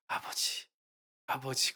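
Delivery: soft imitation of praying before tears; hesitant retold prayer. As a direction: call on Father quietly, tentatively. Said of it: "그러다가 막 울음이 터진 거야". (울음 터지기 전 조심스럽게 작게)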